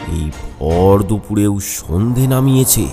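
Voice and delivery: ordinary delivery, nothing marked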